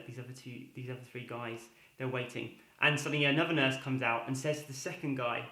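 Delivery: ordinary talk, nothing marked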